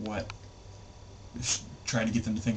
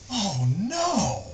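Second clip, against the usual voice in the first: alarmed cry; 9 semitones higher, 4 dB louder than usual. Retold or imitated. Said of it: "and he goes".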